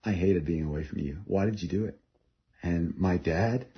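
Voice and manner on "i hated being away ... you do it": quiet confession. Retold or imitated. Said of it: "I said".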